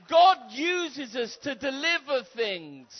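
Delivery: loud, high, driving preacher's call; preaching at full force. Acted. Preacher rousing the church to ask God, fiery preaching. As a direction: whip the crowd up from the pulpit.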